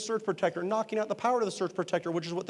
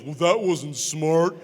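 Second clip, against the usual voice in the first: louder, slow, dry student remark; mock deadpan student; 7 dB louder, much slower than usual. Student in the back mocking the sub's spark mistake, dry and slow. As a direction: say it slow and dry, stating the obvious.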